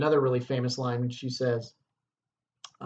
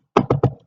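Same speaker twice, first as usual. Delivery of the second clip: sharp gasp; 9 dB louder than usual. Acted. Startled by knocking in scene.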